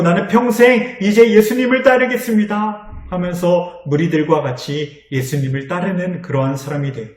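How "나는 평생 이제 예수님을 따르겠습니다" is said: joyful vow. Acted. Healed man vows joyfully.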